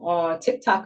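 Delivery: ordinary talk, nothing marked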